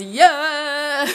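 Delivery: lightly imitated man's cheer; mock manly whoop; deep man voice. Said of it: "deep man voice".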